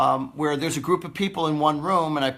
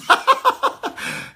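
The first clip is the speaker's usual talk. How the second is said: breathy high burst of laughter; a real high laugh; high airy laugh; 7 dB louder than usual. Real, not acted. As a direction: let out a big squealing laugh. Big laugh at own joke.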